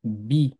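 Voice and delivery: a short, clipped vowel in "bi"; one beat "bi". Shown as a demonstration. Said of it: "ça donnera du coup".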